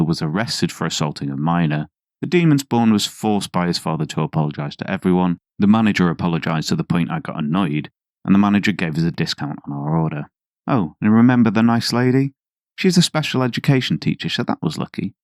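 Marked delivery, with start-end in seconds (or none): none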